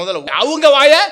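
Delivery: high, loud mock command; mock bossy order. Preacher joking about silencing angry people, hammed-up bossy shout. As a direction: play at shutting their mouths, hammed-up. Jokes about shutting others' mouths.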